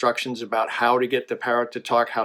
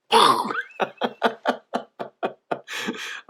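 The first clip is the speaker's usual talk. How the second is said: long laughing fit after a punchline; hearty laugh; 7 semitones higher than usual. Real, not acted. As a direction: burst out laughing at your own joke.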